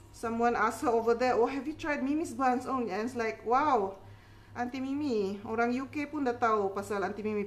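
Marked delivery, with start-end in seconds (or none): none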